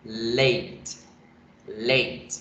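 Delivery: "late" as a single English syllable, leyt; isolated "late", said in one go. Shown as a demonstration. Teacher repeating the word, one-syllable "late". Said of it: "Se pronuncia de una sola vez".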